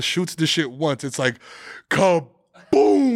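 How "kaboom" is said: loud blast sputtered with spit; spray the boom out; spit take. Real, not acted. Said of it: "I just spit all over the place".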